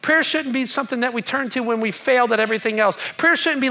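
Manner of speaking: ordinary talk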